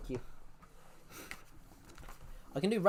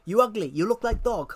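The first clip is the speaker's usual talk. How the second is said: loud, higher, hammed-up Russian accent; mock Russian accent, louder; 3 semitones higher, 4 dB louder than usual. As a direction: yell the insult in a bad Russian accent. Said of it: "she's doing a Russian accent".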